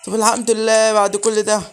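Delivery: high, loud, relieved exclamation; loud relief. Real, not acted. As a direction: cry out thanks after a scare. Relief after finding the key.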